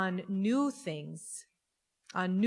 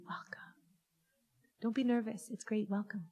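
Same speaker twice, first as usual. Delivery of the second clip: softly whispered welcome; a hushed, tender welcome; 8 dB quieter than usual. Real, not acted. Rabbi welcoming first-time visitors, whispered.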